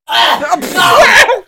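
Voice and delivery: loud disgusted shout; laughing shout. Real, not acted. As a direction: yell no through laughter. Reacting to gross video mention.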